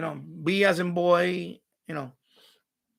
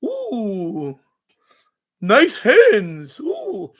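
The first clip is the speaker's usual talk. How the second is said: high, louder mock swoon over nice hands; mocking fans cooing; 8 semitones higher, 9 dB louder, much slower than usual. Streamer mocking viewers who fawn over her hands, swooning mock coos. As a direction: ham up the dazzled viewers. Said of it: "that's why you see that".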